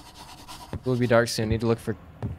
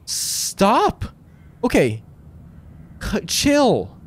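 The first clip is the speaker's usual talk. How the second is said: startled; 7 semitones higher, much slower than usual. Real, not acted. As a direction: yell at the game to stop. Startled by the game.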